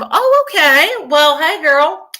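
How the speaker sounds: high, loud bubbly hello; excited greeting. Real, not acted. Greeting a viewer in chat.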